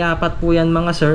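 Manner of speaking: normal talking voice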